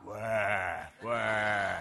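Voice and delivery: put-on low, deep baby wail; deep-voiced toddler cry. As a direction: cry like a little girl with her father's deep voice. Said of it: "his daughter got her dad's deep voice".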